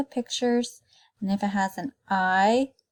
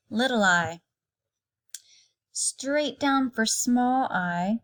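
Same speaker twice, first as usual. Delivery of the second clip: slow demo; 2 semitones higher, slower than usual. Shown as a demonstration. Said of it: "handwriting demo to kindergarten".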